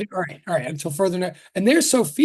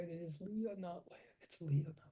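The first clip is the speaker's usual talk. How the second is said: very soft, low self-reminder; under the breath; 4 semitones lower, 20 dB quieter than usual. Real, not acted. Teacher reminding herself how a student's name goes, under the breath. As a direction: rehearse it quietly to yourself.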